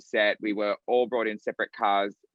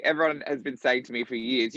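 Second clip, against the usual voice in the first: ordinary talk, nothing marked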